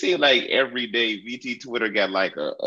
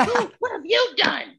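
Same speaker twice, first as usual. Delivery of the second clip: high pitched disbelief; incredulous yell. Real, not acted. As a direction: demand it in outrage. Outraged rhetorical question.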